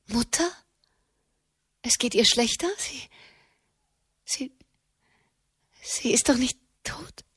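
hushed, dreading question; banges Fragen. A narrator's reading. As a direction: ask softly, almost whispering, afraid of the answer. Tochter fürchtet Tod der Mutter. (bange, fast geflüsterte Frage)

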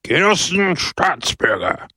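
high public shout to the crowd. Acted. Mayor Pepone hailing the crowd from the balcony, shouting high. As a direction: call out to the square. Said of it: "schrie Pepone".

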